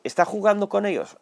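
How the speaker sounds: imitating a doubter asking if God plays games; doubting question. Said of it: "Y dices".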